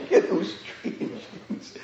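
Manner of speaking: slow, soft words through laughter; laughing softly. Real, not acted. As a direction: chuckle it out. Laughs at own story.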